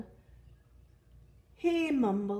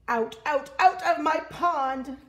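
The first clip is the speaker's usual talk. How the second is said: loud, high, punched repeats; outraged duck shout; angry crying out; 7 semitones higher, 8 dB louder than usual. Acted. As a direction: yell an order to leave. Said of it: "cried Duck".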